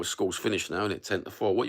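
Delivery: usual speaking voice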